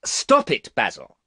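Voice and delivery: high, loud angry shout; furious young man's shout. A narrator's reading. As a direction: shout him down. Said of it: "shouted Dorian".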